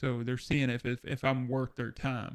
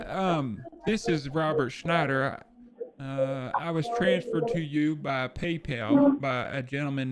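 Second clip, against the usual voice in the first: naive caller voice; 3 semitones higher than usual. Acted. Plays Robert Schneider to scammer.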